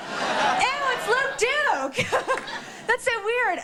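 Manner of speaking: high, slow giggling squeal; squealing disgust. Real, not acted. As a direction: shriek and laugh at the gross fan moment. Squealing, laughing at meeting celebrity.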